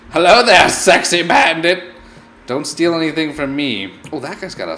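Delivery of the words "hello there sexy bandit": loud greeting. Real, not acted. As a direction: call out sexy bandit cheekily. Greets the bandit loudly.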